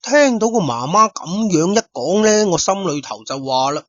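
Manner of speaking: plain reading voice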